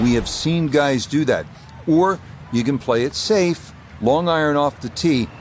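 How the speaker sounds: ordinary delivery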